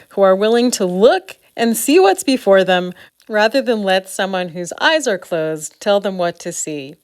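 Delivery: normal talking voice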